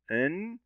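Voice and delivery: "إن" ending in a held N; shadda sounded. Shown as a demonstration. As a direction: hum on the doubled N of "إن". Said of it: "ننطق الشدة". («إن» بنون مشددة ساكنة)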